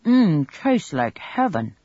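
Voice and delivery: talking through a mouthful; chewing while he speaks; mouth full. A narrator's reading. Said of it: "said with his mouth full".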